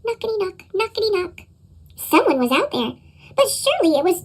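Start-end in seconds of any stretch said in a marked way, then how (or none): 0.0-1.4 soft knocking. A narrator's reading. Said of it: "a soft sound at the door".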